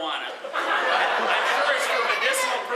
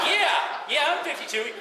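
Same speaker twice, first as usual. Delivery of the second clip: laughing reply; 8 semitones higher, 5 dB louder than usual. Retold or imitated. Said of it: "I said".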